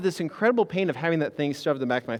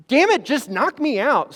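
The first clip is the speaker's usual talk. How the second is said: desperate plea; 8 semitones higher, 6 dB louder than usual. Retold or imitated. Pleading to be sedated.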